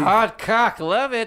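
high, loud celebrity impression; John Lovitz. Comic interrupting as the star, loud high voice. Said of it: "my newly discovered John Lovett".

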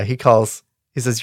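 usual speaking voice

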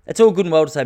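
ordinary delivery

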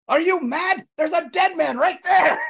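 high, incredulous outburst, slowing on the last words; hammed-up high-pitched protest; hysterical outrage. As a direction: play up the scandalized shock. Acting hysterical lady at detective.

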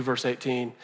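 ordinary delivery

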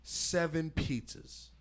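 slow. Retold or imitated. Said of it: "I was like".

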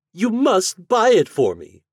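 high, loud spoiled demand; demanding heiress. Acted. Heiress demanding the glass dog, high and loud. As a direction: insist like a spoiled child.

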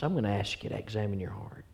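hushed unvoiced plea to look inward; whispered appeal. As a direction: breathe it almost without voice.

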